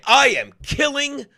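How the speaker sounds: normal talking voice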